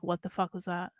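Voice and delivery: hushed retold bewilderment; quiet disbelief. Retelling waking from a strange dream, quiet disbelief. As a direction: drop right down, as if asking yourself.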